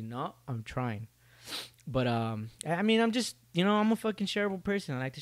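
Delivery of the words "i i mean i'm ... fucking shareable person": higher, fast insistent burst; worked up. Real, not acted. Vlogger defending oversharing, high fast insistence.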